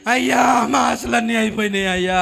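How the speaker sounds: long-drawn mock lament; acted crying, vowels stretched out; imitated wailing. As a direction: wail like a hopeless old mourner. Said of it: "అని ఏడుస్తుంటే".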